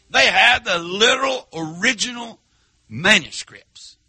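high, ringing preacher's call; full-force preaching. Acted. Preacher driving home the scribes' rejection at full force. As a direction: preach it out to the back pews.

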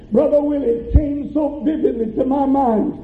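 normal talking voice

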